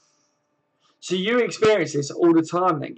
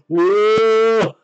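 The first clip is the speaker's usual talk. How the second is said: excited whoa; 5 semitones higher, 6 dB louder than usual. Real, not acted. Streamer reacts to chat joke.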